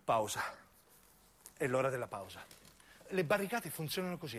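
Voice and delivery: ordinary delivery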